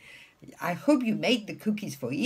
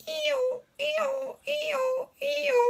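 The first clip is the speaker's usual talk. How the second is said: very high, repeated hee-haws; squealing high-pitched braying; donkey bray; much slower than usual. Retold or imitated. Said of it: "this is a donkey".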